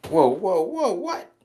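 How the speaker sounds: shocked whoa. Real, not acted. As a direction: caught off guard, voice leaps up on what. Shocked by crude remark.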